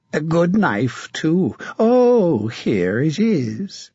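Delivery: a mad, leering knight taunting his captive; sinister, mocking menace; a twisted zealot savouring the moment; creepy villain. Sir Martin finds his knife.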